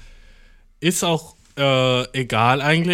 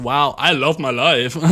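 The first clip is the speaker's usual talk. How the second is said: ordinary talk, nothing marked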